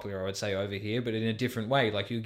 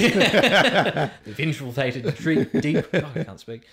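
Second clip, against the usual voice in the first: laughing; 4 semitones higher, 6 dB louder, much slower than usual. Real, not acted. Joking about the experts.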